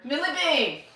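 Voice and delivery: high sing-song call of the dog's name; coaxing call to the dog. Real, not acted. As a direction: call her over from the next room. Calls the dog to come.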